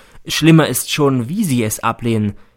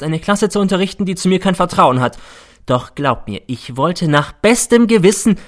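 raised voice; 6 semitones higher, 3 dB louder than usual. Acted. Teacher addresses class, hurt.

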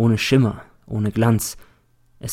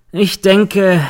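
high, loud, pointed sarcasm; sarcastic; 9 semitones higher, 7 dB louder than usual. A narrator's reading. Defence lawyer needles the girl, sarcastic. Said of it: "meint der Verteidiger sarkastisch".

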